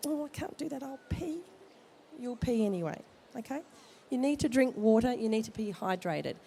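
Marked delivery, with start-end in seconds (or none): 0.1-1.4 higher, softer mock whine; a lightly whined excuse; imitating a reluctant mum-to-be, pitched up; mock whiny pregnant woman. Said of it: "and people go".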